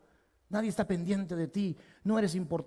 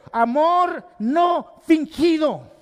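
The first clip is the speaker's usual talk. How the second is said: very slow, every word weighed; solemn slow scripture quote; slow emphatic quote; 9 semitones higher, 8 dB louder, much slower than usual. Acted. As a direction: lay it down word by word. Quoting Paul to Timothy.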